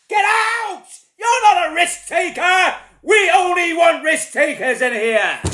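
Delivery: high-pitched comic shout. Acted. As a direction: yell them out of the room.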